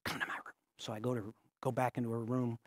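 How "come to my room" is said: soft secretive whisper. Retold or imitated. Girl calling pastor to her room, soft whisper. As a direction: hush it so nobody hears. Girl leads him away privately.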